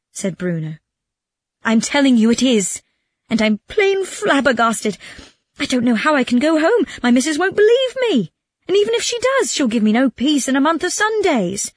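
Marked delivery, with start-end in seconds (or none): 1.6-11.7 high-pitched, worked-up lament; distraught Calandrino. Acted. Robbed man dreads telling his missus, distraught. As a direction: plead and lament, climbing high.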